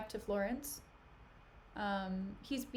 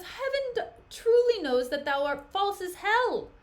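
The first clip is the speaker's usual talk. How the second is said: loud, high-pitched denunciation; acted, theatrical outrage; angry accusation; 8 semitones higher, 7 dB louder than usual. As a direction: hurl the accusation at an unfaithful wife. Othello accuses his wife.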